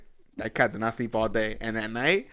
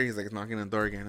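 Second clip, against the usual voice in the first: ordinary delivery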